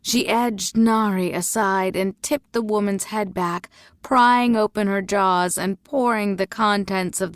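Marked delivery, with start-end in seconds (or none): none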